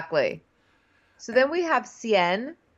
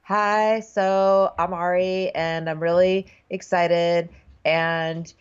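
Ari reading off script; 2 semitones lower than usual. Retold or imitated. Cohost mocks the Bachelor's intro, slow scripted reading. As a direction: drone it out haltingly like memorized lines. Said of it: "like he's just reading off a script".

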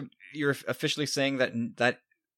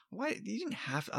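exasperated whine; 7 semitones higher, 10 dB quieter than usual. Retold or imitated. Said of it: "I was always like".

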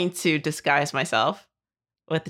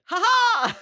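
high, hammy villain chuckle; mustache twirling villain; 13 semitones higher than usual. Acted. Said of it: "twirl my mustache".

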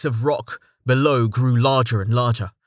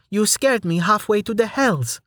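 put-on young woman voice; 10 semitones higher than usual. Amelia startled at Rain.

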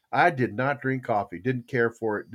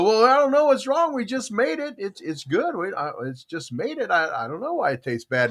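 higher puzzled coworker voice; coworkers voiced; 8 semitones higher than usual. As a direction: play the baffled coworkers. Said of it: "And they go".

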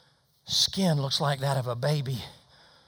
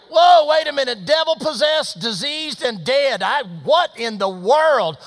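high, shouted disbelief; stunned outburst, much louder and higher; loud astonishment; 14 semitones higher, 15 dB louder than usual. Retold or imitated. Visitor reacts to the three stories, astonished.

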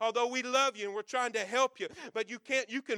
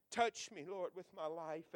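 hushed prayer; 5 semitones lower, 9 dB quieter than usual. Real, not acted. Whispered prayer after the preaching.

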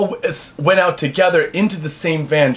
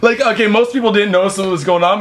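ordinary talk, nothing marked

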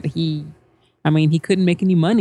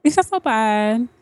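higher, mock little-girl imitation; little girl talk; 5 semitones higher than usual. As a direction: echo the girlish voice, pitched up. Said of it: "She talks like this all the time".

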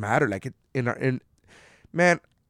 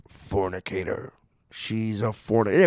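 mock grave intoning; 3 semitones lower than usual. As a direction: pronounce it like a biblical condemnation.

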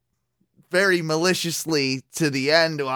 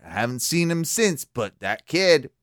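normal talking voice

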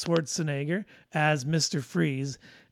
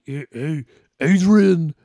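a lightly voiced Rocky Balboa, calling out; mumbled Rocky call with the name pushed louder; half-hearted Stallone, Adrian rising loud at the end; Stallone impression; 8 dB louder, slower than usual. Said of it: "I can barely do Stallone".

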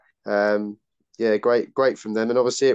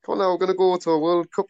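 put-on quick, higher-pitched try at an Irish accent; attempted Irish accent; 6 semitones higher, faster than usual. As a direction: badly imitate excited Irish fans, Irish accent. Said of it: "I can't do an Irish accent".

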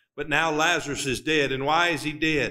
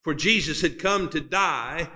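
usual speaking voice

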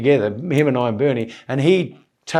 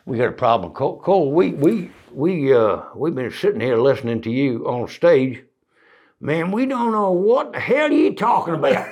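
put-on Southern US accent. [name]'s old band member confronting the comedian, baffled Southern American accent. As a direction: imitate a puzzled American musician with a Southern accent. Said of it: "half of them were ex-[name] band".

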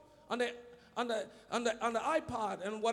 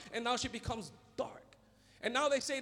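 ordinary talk

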